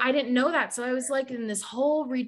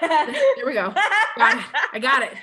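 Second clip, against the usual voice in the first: laughing; 5 semitones higher, 6 dB louder than usual. Real, not acted. Laughing as tears start.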